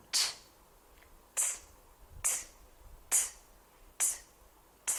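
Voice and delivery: crisp "t" taps; t sound. Shown as a demonstration. Teacher repeating the t sound for children. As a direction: tap "t" out in short clicks. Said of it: "is the sound".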